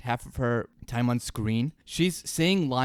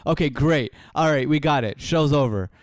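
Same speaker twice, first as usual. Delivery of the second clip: put-on louder, higher bossy director coaching a child actor; impatient director on set, loud and higher; mock film director; 3 semitones higher, 6 dB louder than usual. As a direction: bark out directions to make her cry on cue. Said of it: "They're like".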